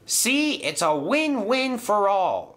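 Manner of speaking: high-pitched eager enthusiasm; enthusiastic. A narrator's reading. James selling his idea to Sir Topham Hatt, enthusiastically. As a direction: pitch it right up, keen to convince. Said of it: "said James enthusiastically".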